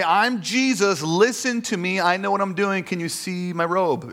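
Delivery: high, loud, bossy proclamation; mock self-importance. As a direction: demand attention with hammed-up authority. Mocks a commanding, self-important Jesus.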